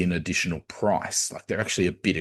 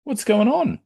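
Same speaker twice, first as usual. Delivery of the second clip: high-pitched puzzled concern; concerned questioning; 11 semitones higher than usual. Acted. Coach checking on his upset player, high concerned voice. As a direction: ask haltingly, pitched well up. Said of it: "he goes over to Fed and goes".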